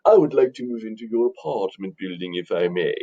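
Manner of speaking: very rich, famous doctor talking fancy; mannered, fancy-talking gentleman; fancy talker. Acted. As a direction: put on a refined, fancy doctor's voice. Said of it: "he talks fancy and wears fancy clothes".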